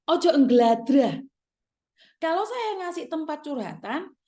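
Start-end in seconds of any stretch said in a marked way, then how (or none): 0.1-1.2 stern scolding. Real, not acted. Scolding the workers firmly.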